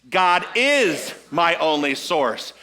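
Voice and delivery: "is" held long and punched, slow line; stress on is. Shown as a demonstration. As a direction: hit the word "is" and stretch it. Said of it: "emphasize a different word".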